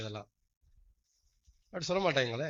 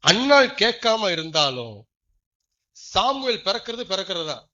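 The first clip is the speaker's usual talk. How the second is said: loud preaching; 3 semitones higher, 9 dB louder than usual. Acted. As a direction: proclaim it to the whole church. Preaching proclamation. (உரத்த பிரசங்க அறிவிப்பு)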